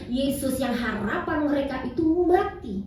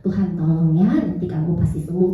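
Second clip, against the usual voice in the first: soothing mother; 8 semitones lower than usual. Retold or imitated. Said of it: "Terus saya bilang".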